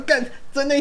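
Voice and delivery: high, startled cry of disbelief; surprised shout. Retold or imitated. Friend on the phone spotting her, shocked yelp.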